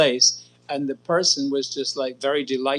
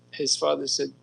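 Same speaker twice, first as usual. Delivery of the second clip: ordinary talk, nothing marked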